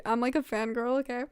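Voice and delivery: normal talking voice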